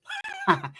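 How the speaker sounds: very high squealing laugh; high-pitched laugh. Real, not acted. Storyteller cracks up after needling his homie, high laugh. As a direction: let out a high, wordless laugh.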